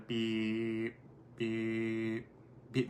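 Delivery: long, level held beeps; monotone machine beeping; imitated dial-up beep. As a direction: mimic a modem tone, drawn out.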